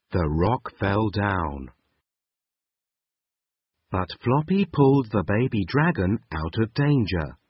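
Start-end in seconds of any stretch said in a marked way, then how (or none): none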